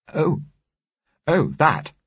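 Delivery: high, loud oh, then brisk disdain; dawning recollection. A narrator's reading. Woman remembering a disreputable book writer, dawning disapproval. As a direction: recall it, then dismiss it haughtily. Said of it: "Then I saw recollection dawn".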